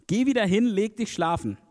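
high-pitched acted order back to bed; Eli sending Samuel back.

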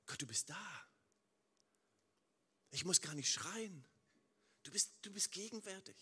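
leise, langsame, innige Gebetsstimme; leises Gebet. Acted. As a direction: sprich das Gebet still und andächtig, mit Pausen. Gebetetes Dankgebet an Gott. (leises, andächtiges Gebet)

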